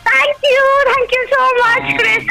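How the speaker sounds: delighted thanks. Real, not acted. Caller thrilled to win.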